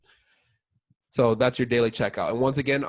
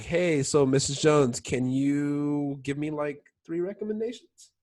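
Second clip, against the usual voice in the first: slow, hesitant ask with a rising questioning end; unsure, questioning; 2 semitones higher, slower than usual. Shown as a demonstration. Rep timidly asks Mrs. Jones for recommendations, unsure and questioning. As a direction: draw it out as if nervous to ask. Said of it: "I say it like it's a question".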